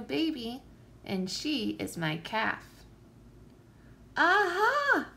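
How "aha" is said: loud, high cry of discovery; excited kids shouting aha. A narrator's reading. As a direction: shout it out like you cracked the case. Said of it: "we all shouted".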